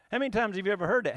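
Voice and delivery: normal talking voice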